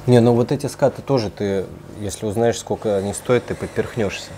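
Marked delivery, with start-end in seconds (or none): none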